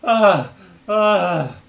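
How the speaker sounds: loud, high panting groans; acted winded "ah"; out-of-breath groan. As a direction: pant hard with a voiced groan. Acts the character running, panting.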